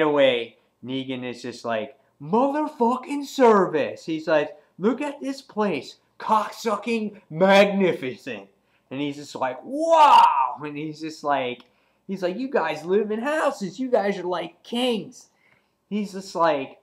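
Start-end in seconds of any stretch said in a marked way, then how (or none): 2.2-4.0 high, loud gleeful exclamation; hammed-up delight, pitched high; acted amazement. Negan marvelling at the town. 4.8-8.5 high-pitched gleeful marvelling; hammed-up admiration, pitched high; acted awe. Negan marvelling at the town. 9.6-10.6 big, loud, high wow; drawn-up theatrical astonishment; acted amazement. Negan marvelling at the town. 12.4-15.3 acted amazement. Negan marvelling at the houses.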